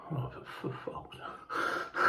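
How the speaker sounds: slow, breathy whispered curse under the breath; a voiceless groan of frustration; exasperated whisper. Real, not acted. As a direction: mutter it to yourself, fed up and defeated. Exasperated at cancelled trains.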